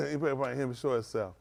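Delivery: usual speaking voice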